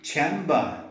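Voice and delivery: chamber in a British accent, no r sound. Shown as a demonstration. Teacher showing British chamber with a silent r. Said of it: "In British English it should be chamber".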